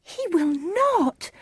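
very high, drawn-out protest; distressed high-pitched outcry; crying out. Acted. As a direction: wail a refusal, high and slow. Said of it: "cried Juliet".

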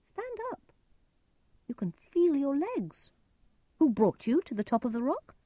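very high put-on voice of a stranger; disguised stranger's voice. A narrator's reading. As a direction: call out high, pretending to be somebody else. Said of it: "Edgar used a different voice".

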